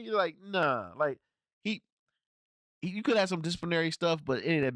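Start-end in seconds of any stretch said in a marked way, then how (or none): none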